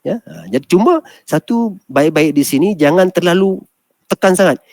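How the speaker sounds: usual speaking voice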